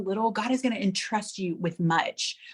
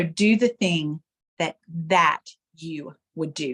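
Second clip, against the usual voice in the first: second "that" set apart and stressed; slow down and hit "that"; emphatic THAT, slow falling "you would do"; 3 semitones lower than usual. Shown as a demonstration. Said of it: "put the emphasis on a different word".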